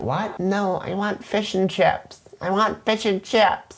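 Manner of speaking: high-pitched, baffled customer; confused film heroine. Acted. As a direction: insist in a put-on high girlish voice. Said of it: "And she's like".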